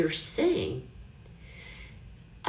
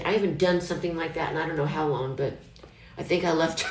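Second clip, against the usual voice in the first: usual speaking voice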